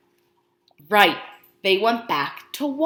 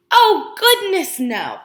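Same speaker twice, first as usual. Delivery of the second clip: storybook exclamation; 6 semitones higher, 8 dB louder, slower than usual. Acted. Story reassurance.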